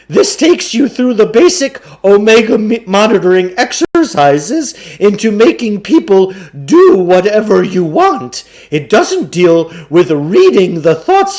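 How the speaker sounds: a slick con man pitching the deal; booming carnival barker sell; pushy salesman. A narrator's reading. Klugarsh pitches his course.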